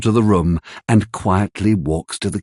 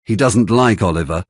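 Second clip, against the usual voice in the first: usual speaking voice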